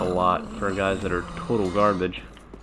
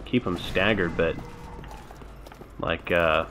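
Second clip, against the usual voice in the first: normal talking voice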